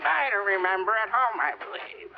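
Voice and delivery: parroting the senator's words with contempt; mocking echo. Witness mocks the senator's dodge by repeating it. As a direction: throw his own evasive answer back at him.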